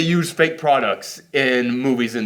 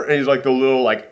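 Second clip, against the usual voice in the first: ordinary talk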